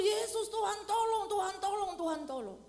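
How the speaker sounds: loud, high pleading shout; crying out for help. Acted. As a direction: scream for the Lord to save you. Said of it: "Dia berseru". (seruan minta tolong, keras dan tinggi)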